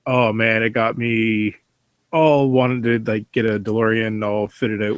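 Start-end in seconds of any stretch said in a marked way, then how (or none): none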